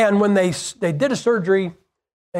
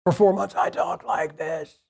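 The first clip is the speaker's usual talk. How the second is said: lost voice; 4 dB quieter than usual. Shown as a demonstration. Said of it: "I lost my voice for four months".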